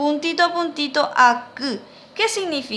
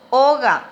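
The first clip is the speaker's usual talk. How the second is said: "oga" louder, O stressed, falling away; 5 dB louder than usual. Shown as a demonstration. Teacher repeats the word for the class, stressed O. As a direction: punch the O of "oga", then drop. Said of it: "lleva acento en la O".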